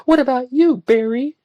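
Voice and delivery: high young woman's voice, lightly acted; Joanna's voice. A narrator's reading. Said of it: "she asked back".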